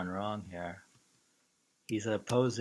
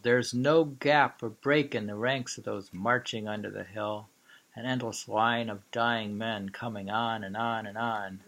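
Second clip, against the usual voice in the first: louder, solemn reading; poem recital; 6 dB louder than usual. Acted. Lecturer rereading the war poem to the class, louder. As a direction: read the war poem out with force.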